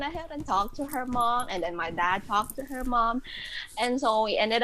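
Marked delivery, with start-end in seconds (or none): none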